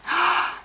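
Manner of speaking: quick high gasp of delight; small gasp. Real, not acted. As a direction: catch your breath at a pretty find. Gasp while unpacking goodies.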